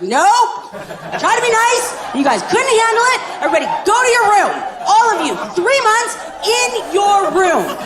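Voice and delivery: a dad who's had it, shouting orders; fed-up stepdad. Acted. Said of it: "He's like".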